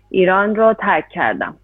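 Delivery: ordinary talk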